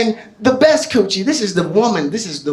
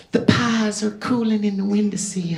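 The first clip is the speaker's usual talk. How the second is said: put-on Southern belle accent; 7 dB quieter than usual. Comic voicing his girlfriend on request, Southern belle accent. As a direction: play a sweet Southern belle, softly. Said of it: "she's a Southern belle".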